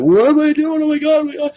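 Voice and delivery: loud, high-pitched panicked wail; crying freak-out. Retold or imitated. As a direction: re-enact crying in horror at what you did. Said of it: "I was crying".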